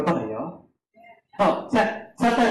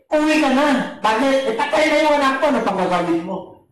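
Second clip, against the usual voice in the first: high, louder pleading call; urgent messenger; 8 semitones higher, 3 dB louder than usual. Acted. Servant urging Jairus to go home, high and urgent. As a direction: blurt the bad news to make him give up.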